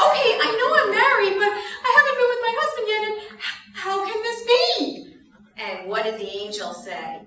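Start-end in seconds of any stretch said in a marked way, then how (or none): 0.1-5.2 put-on high, loud, fast panicky girl; freaking out Mary. Teacher acting Mary panicking to the children, high and frantic. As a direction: rattle it off in a high flustered panic. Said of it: "She's like".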